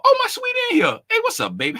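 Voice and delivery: high, affectionate cooing hello; sweet high greeting. Real, not acted. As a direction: coo at a favorite viewer.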